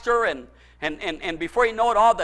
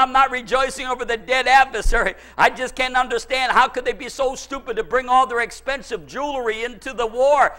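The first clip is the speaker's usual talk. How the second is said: ordinary talk, nothing marked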